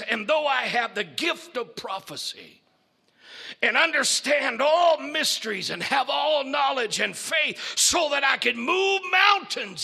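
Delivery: booming beast. Acted. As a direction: preach it at the doctor, building. Beast declaims loudly.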